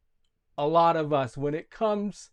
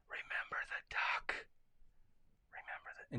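whisper in ear; 18 dB quieter than usual. Acted. Jenny leaning over to blackmail Johnny, whispering. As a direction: whisper it like a secret threat. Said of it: "she whispers in his ear".

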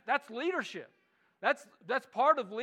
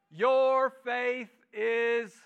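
slow emphatic praise; 3 semitones higher, 8 dB louder, much slower than usual. Acted. Jesus commends the woman.

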